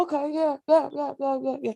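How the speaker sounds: high, offhand string of yeahs; dismissive yeahs. Pretend. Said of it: "I'll go".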